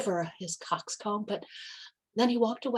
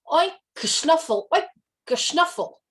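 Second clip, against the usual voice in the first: pig's attempt to crow; 7 semitones higher, 7 dB louder than usual. A narrator's reading. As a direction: snort it out like a proud pig. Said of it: "cleared his throat".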